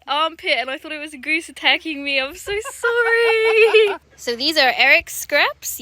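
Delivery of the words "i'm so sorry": laughing apology. Real, not acted. Apologizing to the dog.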